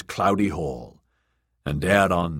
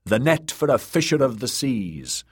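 raised, higher-pitched reply; moderately projected answer; shouting back; 6 semitones higher than usual. Acted. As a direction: call it back to the speaker. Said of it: "Rus shouted back".

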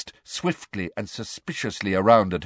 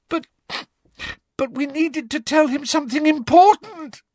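high, loud tearful protest; stumble, then wail it out; hammed-up fake crying; 5 dB louder than usual. A narrator's reading. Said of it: "wailed Hermione".

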